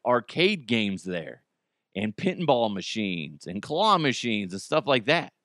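normal talking voice

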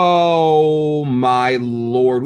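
long drawn-out, loud "oh my lord"; overwhelmed exclamation. Real, not acted. Podcaster reacting to a gory episode, overwhelmed. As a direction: stretch it right out in amazement.

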